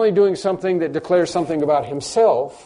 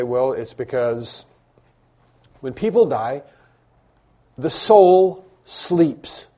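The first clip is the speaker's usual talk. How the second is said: very slow, drawn-out, louder on the soul sleeps; quoting a mistaken view; 3 dB louder, much slower than usual. Retold or imitated. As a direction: spell out the other side's claim slowly. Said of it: "some people say".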